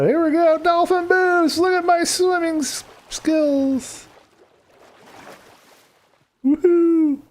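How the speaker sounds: high, gleeful whooping; excited. Real, not acted. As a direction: ride the boost with pure glee. Riding the dolphin boost.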